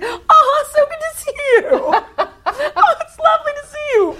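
very high, loud, laughing gush; overly sweet greeting. Retold or imitated. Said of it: "I'm like".

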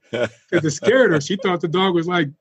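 ordinary talk

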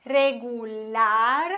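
regular, slowly, stress on the last syllable lar; slow Spanish example. Shown as a demonstration.

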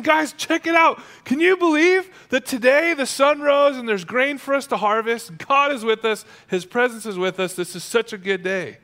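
high, loud joyful amazement, gradually settling; acted excitement. Boaz marvelling with workers at the harvest, acted excitement. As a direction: burst out high and thrilled, then ease down.

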